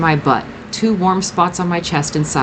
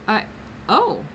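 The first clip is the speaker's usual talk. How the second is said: surprised; 7 semitones higher than usual. A narrator's reading.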